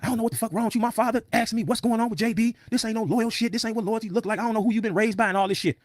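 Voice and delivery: high-pitched mock rant; imitated angry tirade, high and insistent; ranting and raving. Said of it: "ranting and raving".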